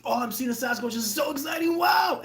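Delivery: high, shouting gush of excitement; mock fanboy yelling at a Sasquatch; overexcited. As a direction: squeal like a starstruck fan. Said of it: "I get too excited".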